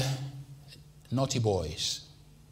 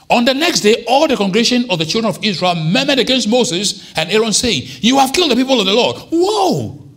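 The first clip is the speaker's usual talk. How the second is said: much higher, much louder preaching cry; full-force preaching; 10 semitones higher, 8 dB louder than usual. Acted. Preacher telling the congregation of the rebellion, at full force. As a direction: preach it out to the back row.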